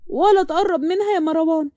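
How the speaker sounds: high, possessive and worked up; heated jealous girlfriend in full flow; jealous outburst. Acted.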